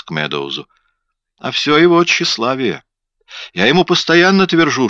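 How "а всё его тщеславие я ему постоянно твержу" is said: high-pitched nagging wife, louder as it goes; exasperated complaint. A narrator's reading. Wife complains to police about husband's vanity, exasperated.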